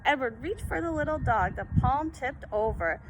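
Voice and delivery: usual speaking voice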